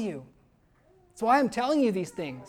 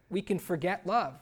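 normal talking voice

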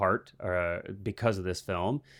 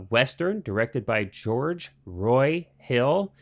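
carefully slow over the name; much slower than usual. Real, not acted. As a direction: spell out the director's name slowly. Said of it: "I always have to slow that down".

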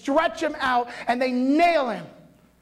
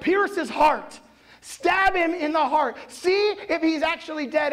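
lightly voiced soldier, pitched higher; retold soldiers' order; 7 semitones higher than usual. Roman soldiers deciding what to do, a lightly voiced order. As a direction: give a mock command, raised in pitch.

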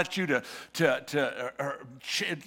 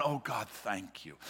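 quiet, lower, breathed thanks; soft prayer; 6 semitones lower, 10 dB quieter than usual. Acted. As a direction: murmur it like a private prayer. Preacher voices grateful prayer.

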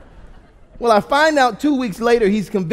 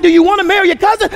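fast, high, very loud challenge; imitated dad yelling; 11 semitones higher, 10 dB louder, much faster than usual. Dad challenging his son about marrying a cousin, yelling. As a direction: fire the question at the kids as a yelling dad. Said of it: "he starts yelling at us".

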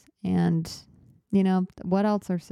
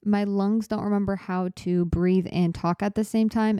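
ordinary talk, nothing marked

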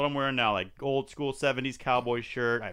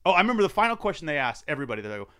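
ordinary delivery

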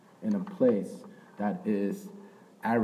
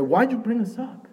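much higher, louder whiny outcry; complaining Israelites; 7 semitones higher, 6 dB louder than usual. Retold or imitated. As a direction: wail the complaint up at God. Voicing grumbling Israelites at God.

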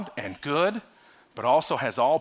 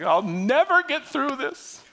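despairing whine; 10 semitones higher, slower than usual. Retold or imitated. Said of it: "And you're just like".

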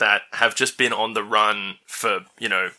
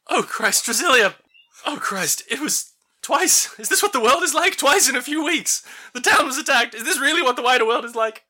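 much higher, strained worried father voice; Enos voice; 14 semitones higher than usual. Acted. Enos the father to daughter Drasilia, high and distraught. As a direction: pitch way up and fret like a shaken old dad. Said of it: "whose voice I will now attempt to recreate".

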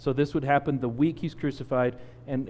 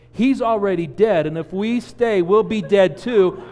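high, urgent and louder, slowing on the end; frantic warning; 5 semitones higher, 6 dB louder than usual. Retold or imitated. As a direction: insist in panic that he's gone. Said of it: "Angie goes".

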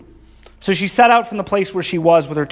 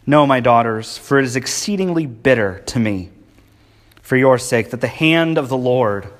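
lower, heavy, bitter reading; 4 semitones lower than usual. Acted. Naomi telling her daughters to leave, bitter. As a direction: let the bitterness weigh it down. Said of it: "Bitterness from emptiness".

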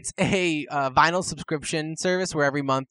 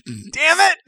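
loud; 12 semitones higher, 8 dB louder than usual. Real, not acted. Caught out, mock frustration.

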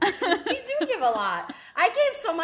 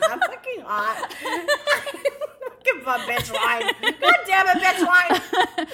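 high, laughing joking demand; 4 semitones higher, 4 dB louder, slower than usual. Real, not acted. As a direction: whine it through laughter.

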